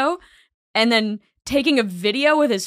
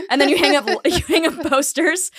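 normal talking voice

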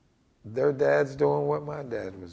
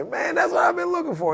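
much higher, bright burst of discovery; delighted; 3 dB louder than usual. Retold or imitated. Student meeting a partner, lightly acted delight. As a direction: sound like you found the missing piece.

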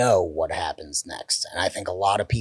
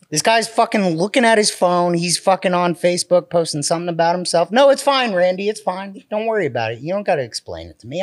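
loud, high, teasing scold; mock scolding; 10 semitones higher, 6 dB louder than usual. Real, not acted. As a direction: playfully chew out a distracted friend. Teasing friend about his phone.

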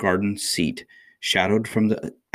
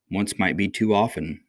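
usual speaking voice

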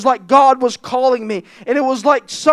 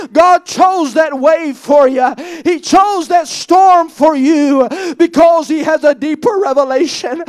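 fervent preaching; 3 semitones higher, 3 dB louder than usual. Real, not acted. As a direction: preach it out loud. Preaching at full force.

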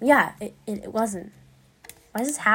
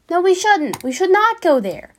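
firm no; 8 semitones higher, 6 dB louder than usual. Real, not acted. Firm refusal after whisper.

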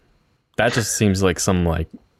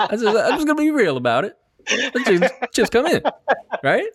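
high, giggling through the words; laughing; 12 semitones higher than usual. Real, not acted. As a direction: laugh while you say it. Laughing while repeating the line.

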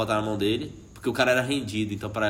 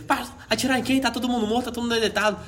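high exasperated yelling; 10 semitones higher than usual. Retold or imitated. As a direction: shout back in disbelief. Norman answering the yelling sergeant.

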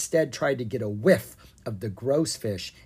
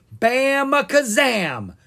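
high, loud, slow spell cry; shouted spell; 11 semitones higher, 7 dB louder, much slower than usual. Acted. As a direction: blast out the magic word. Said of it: "Ken shouted".

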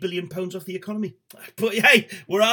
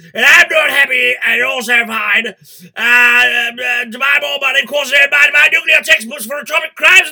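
military voice; 7 semitones higher, 11 dB louder than usual. Acted. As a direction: bellow the song lyrics as a parade-ground order. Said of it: "The Jam done in the military voice".

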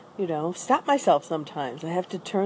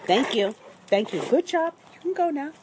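higher pet-talk praise; praising the dog; 8 semitones higher than usual. Real, not acted. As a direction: coo a quick good job to the dog. Praising the dog mid-video.

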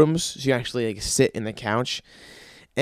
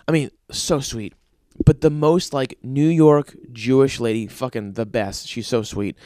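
ordinary talk, nothing marked

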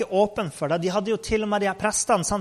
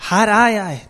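loud answer, lightly raised; eager call; 3 semitones higher, 7 dB louder than usual. Acted. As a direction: call out that you are present. Samuel melder seg tredje gang. (høyt, villig svar)

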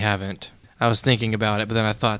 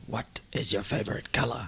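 whispering; 8 dB quieter than usual. Pretend. Bridge-keeper quote at bridge.

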